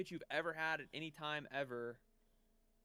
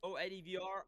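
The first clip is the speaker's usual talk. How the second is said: normal talking voice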